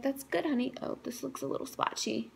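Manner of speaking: mom's soft, offhand reply; imitating mom. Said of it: "And she was like".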